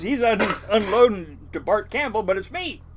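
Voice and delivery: usual speaking voice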